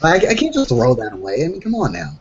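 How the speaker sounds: ordinary talk, nothing marked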